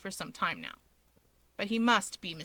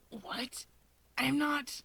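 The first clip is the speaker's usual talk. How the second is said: much softer, flustered and startled; choked denial; 3 semitones higher, 5 dB quieter, much slower than usual. Acted. Shigaraki to Natsuo, choking out a denial. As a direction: sputter a denial. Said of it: "Shigaraki chokes".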